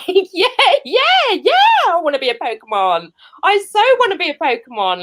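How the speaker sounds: high-pitched, laughing mock enthusiasm; hammed-up excited yes; over-eager. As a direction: gush eagerly, desperate for the job. Acting out faked eagerness to agent.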